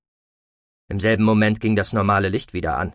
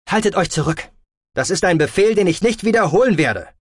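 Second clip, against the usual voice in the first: raised, forceful command; stern leader; 9 semitones higher, 5 dB louder than usual. A narrator's reading. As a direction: give an order that allows no argument, loud and high. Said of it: "sagte der Anführer streng". (streng befehlend, mit erhobener Stimme)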